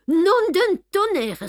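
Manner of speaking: cri soudain, beaucoup plus aigu et fort; sudden outcry. A narrator's reading. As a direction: blurt it out as the truth strikes. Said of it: "s'écria Poirot tout d'un coup".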